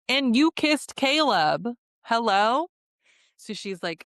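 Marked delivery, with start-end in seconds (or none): 0.1-2.7 higher, stretched-out mock outrage; indignant accusation. Retelling a contestant confronting their partner over a kiss, indignant. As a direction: throw the accusation out incredulously.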